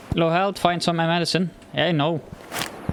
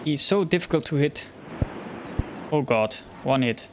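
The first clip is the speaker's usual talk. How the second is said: ordinary delivery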